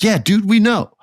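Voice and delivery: high, loud know-it-all retort; exasperated fan chorus; impatient fans. Acted. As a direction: answer as fans who already know. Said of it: "all the fans are like".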